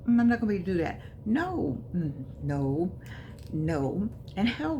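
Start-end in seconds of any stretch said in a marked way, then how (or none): none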